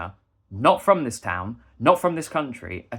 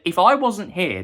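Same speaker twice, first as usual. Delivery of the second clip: louder, higher angry point; retold heated retort; 5 semitones higher, 5 dB louder, slower than usual. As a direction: snap it back at him, raised. Retold argument, heated quote.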